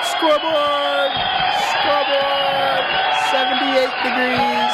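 triumphant shout. Real, not acted. Gloating to wife about beating in-laws, slow triumphant shout. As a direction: crow it like you won the game. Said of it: "gloating shout".

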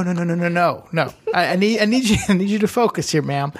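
ordinary talk

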